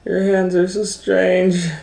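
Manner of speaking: put-on a wide-eyed little girl asking.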